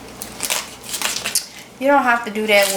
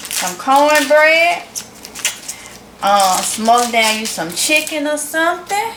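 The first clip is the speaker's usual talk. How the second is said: usual speaking voice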